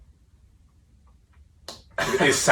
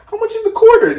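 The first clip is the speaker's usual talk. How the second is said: high squeaky mimic; Gary Vee impression; 7 dB louder than usual. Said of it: "just going on in your brain".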